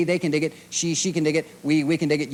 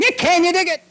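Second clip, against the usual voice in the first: shouted challenge; 12 semitones higher, 6 dB louder than usual. Acted.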